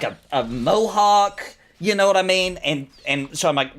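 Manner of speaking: ordinary talk, nothing marked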